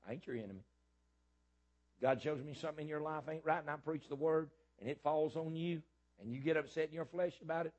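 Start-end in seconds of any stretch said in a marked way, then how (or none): 2.0-7.7 higher, building sermon cadence; rising preaching. Acted. Preacher building toward the church, voice higher. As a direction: build the point up toward the congregation.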